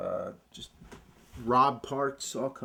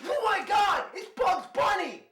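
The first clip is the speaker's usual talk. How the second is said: high excited shout. Acted. Repeated high Bugs Bunny exclamation.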